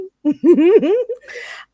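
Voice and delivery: high, loud giggle; laugh. Real, not acted. Host laughing off her own rant to viewers, high giggle. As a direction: burst into a high-pitched laugh.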